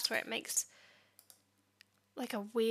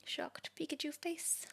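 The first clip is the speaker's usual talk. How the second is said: whispering; 3 semitones higher, 7 dB quieter, slower than usual. Real, not acted. Deadpan aside after failure.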